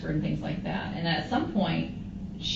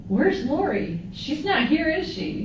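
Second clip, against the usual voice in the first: much higher, louder worried asking; anxious question; 10 semitones higher, 4 dB louder, slower than usual. Retold or imitated. To Charles at the house, high worried questions. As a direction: rise in alarm as you ask. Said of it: "And I'm like".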